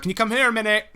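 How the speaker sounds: high-pitched, imitated call through the house; retold call to wife. Calling to wife in story.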